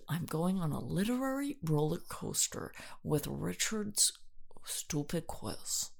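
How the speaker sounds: unvoiced whisper. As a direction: whisper it like a secret. Whispered acted reply.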